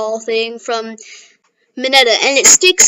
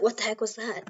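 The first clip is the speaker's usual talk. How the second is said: much softer, hushed bewilderment; shaken; 3 semitones lower, 15 dB quieter than usual. Retold or imitated. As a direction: murmur it, unsettled by what you saw. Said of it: "And he's like".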